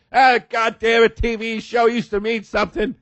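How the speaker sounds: high, put-on voice of a fed-up maid; a high, hammed-up cleaning lady complaining; griping maid. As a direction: moan about the bathroom business like a jaded maid. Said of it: "maids walking around going".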